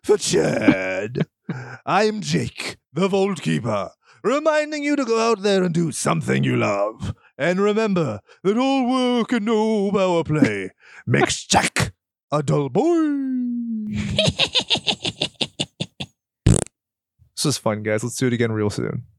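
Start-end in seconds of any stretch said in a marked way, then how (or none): 0.0-13.9 slow, higher spooky host; Vault Keeper voice. Acted. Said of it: "I will finish as the Vault Keeper". 13.9-16.0 long, very high cackle; spooky host cackle. Acted. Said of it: "best Tales from the Crypt laugh".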